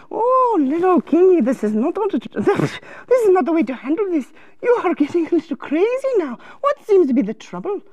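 lilting, gently concerned advisor voice; sing-song storybook helper; fussing counselor. Acted. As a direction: coo at a troubled little king.

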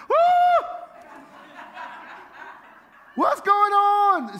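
very high, loud whoop that drags into a slow "what's going on"; shocked yelp. Retold or imitated. Husband reacting to wife doubling the grocery budget, a loud shocked whoop. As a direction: yelp in comic disbelief at the bill.

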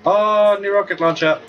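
loud, high thrilled gasp at new loot; excited discovery. Real, not acted. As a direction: cheer at the shiny new gun. Finds new weapon.

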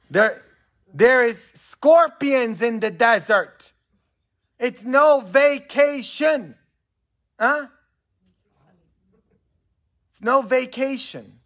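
slow, high, loud proclamation; fervent preaching. Acted. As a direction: hammer the warning home word by word. Preacher at full force.

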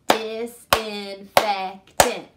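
slow, loud "Disinfectant" beat by beat; slow clapped syllables. Shown as a demonstration. Teacher clapping a long word for pupils. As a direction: hammer out every syllable of "Disinfectant" slowly. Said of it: "Ready? Go".

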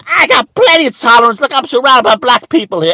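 fast indignant comeback; defensive retort. Retold or imitated. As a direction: fire back defensively.

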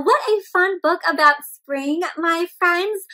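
ordinary delivery

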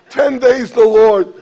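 ordinary talk

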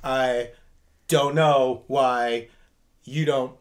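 low, slow wooden reading; mock stilted line. Showing how he'd deliver a line, low and slow. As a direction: play a wooden actor. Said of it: "delivering you lines".

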